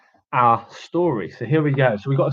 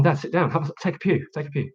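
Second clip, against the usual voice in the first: ordinary talk, nothing marked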